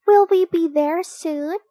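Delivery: put-on high, loud child's question; little sister bear. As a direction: voice an eager little bear cub asking. Child bear asks during car trip.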